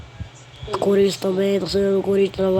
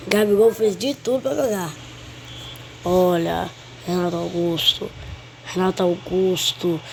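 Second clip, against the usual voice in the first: ordinary talk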